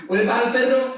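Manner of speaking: parent's orders. Retold or imitated. Preacher voices a parent calling chores, loud orders. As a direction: call out chores like a mum.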